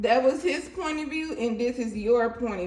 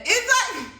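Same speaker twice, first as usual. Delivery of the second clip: high exclaim; 10 semitones higher, 6 dB louder than usual. Real, not acted. Sudden high burst.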